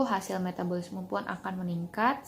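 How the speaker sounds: normal talking voice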